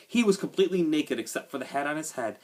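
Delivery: ordinary delivery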